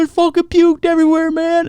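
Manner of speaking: high miserable cry; high distressed yell. Retold or imitated. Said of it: "I was like".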